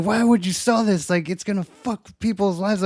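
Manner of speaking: high-pitched imitation of protest; mock indignant. Customer blaming clerk for selling the item, mock indignant.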